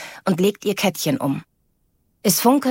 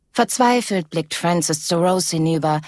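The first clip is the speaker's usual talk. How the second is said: plain reading voice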